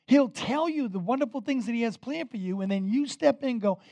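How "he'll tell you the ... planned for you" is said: normal talking voice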